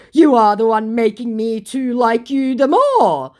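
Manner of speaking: high, loud, overblown declaration; hammed-up lovesick protest; mock smitten scammer. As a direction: gush it out mockingly. Scammer's smitten message, acted out.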